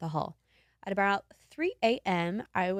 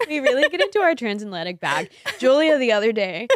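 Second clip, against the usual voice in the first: put-on old-Hollywood transatlantic lilt, higher and louder; transatlantic accent; 5 semitones higher, 12 dB louder, faster than usual. Two friends hamming up their transatlantic accent. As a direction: put on a posh mid-Atlantic accent. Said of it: "Transatlantic accent coming out".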